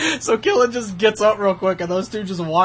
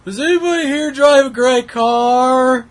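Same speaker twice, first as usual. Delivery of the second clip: slow loud call across the room, higher; mock-yell the question to the whole restaurant; retold yelling; 8 semitones higher, 8 dB louder, slower than usual. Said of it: "just starts yelling".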